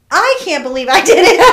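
loud, high, laughing joy; laughing disbelief. Retold or imitated. Hiker answering her friends, loud laughing joy. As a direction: burst out laughing with triumph. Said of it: "I said".

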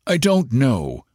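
a father answering with a touch of stone; stony. A narrator's reading. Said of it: "said the father stonily".

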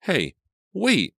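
high, loud urgent cry. A narrator's reading. Girl stopping the caller from hanging up, urgent cry. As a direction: call out sharply to stop someone. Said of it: "Selina cried".

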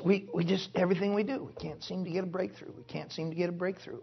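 imitated defeat, very soft and low; discouraged pastor. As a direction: quietly lament like a stuck church planter. Quotes discouraged New England church planter.

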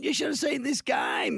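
mocking mates. Retelling mates raving about the game, high mock excitement. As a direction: squeal it like over-the-top friends. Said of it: "all my mates are going".

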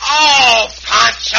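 high, loud groan at a bad joke; laughing exasperated oh Pancho; mock exasperation.

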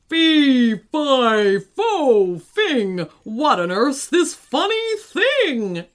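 big loud slow giant voice; booming giant voice. A narrator's reading. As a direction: boom it out like a giant. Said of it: "announced the giant in his booming voice".

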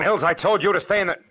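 loud, cut-off yell; startled shout. Acted. Detective yells at Mills for leaving the house, loud and cut off. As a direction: shout at him, then break off. Mills surprises them with a gun.

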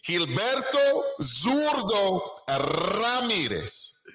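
high, slow Spanish name roll-out; ring announcer flourish. Shown as a demonstration. Putting respect on the boxer's name, grand announcer flourish. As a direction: draw each name out grandly with a rolled R.

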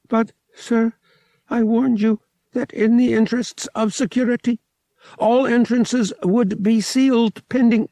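prim droid, a bit rattled; shaken droid. A narrator's reading. Droid answering pilot after crash, shaken droid voice.

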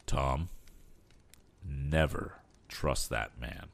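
low, slow, grave warning; solemn advice. A narrator's reading. Aged inventor warning his son about the agent, solemn. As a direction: weigh each word as a solemn caution. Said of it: "advised the aged inventor solemnly".

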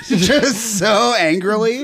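slow, high laughing words. Real, not acted. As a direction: drag it out laughing. Laughing overstatement.

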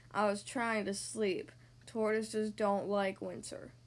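low, slow tortoise groan. A narrator's reading. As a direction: groan it slowly, longing for sleep. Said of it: "groaned Tortoise".

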